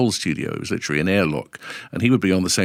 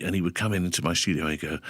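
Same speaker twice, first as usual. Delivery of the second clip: usual speaking voice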